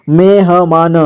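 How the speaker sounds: मेहमान with ह given its own vowel; syllable by syllable. Shown as a demonstration. Teacher breaking down मेहमान for learners, syllable by syllable. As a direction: sound out मेहमान piece by piece. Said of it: "जोड़ जोड़ करके".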